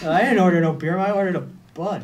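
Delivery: put-on drunk slurring. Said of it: "everyone slurring".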